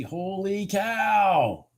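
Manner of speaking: real astonishment at the chart; amazed. Real, not acted. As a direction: stretch it out in disbelief. Reacts to big candle.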